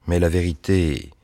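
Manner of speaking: plain reading voice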